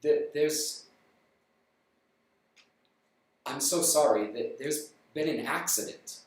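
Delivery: low, soft, halting messenger; a grave man dreading his news; nervous solemn stranger. Acted. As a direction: stranger bracing to tell the parents.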